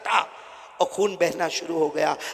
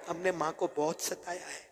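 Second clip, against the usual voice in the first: much softer, grieving; soft lament; 11 dB quieter than usual. Acted. Preacher softly lamenting to the congregation. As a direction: drop the voice in regret.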